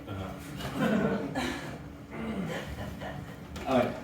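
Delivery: usual speaking voice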